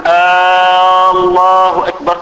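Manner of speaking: mock adding a long aah before الله أكبر; wrong takbir with added aah. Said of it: "لا يقول".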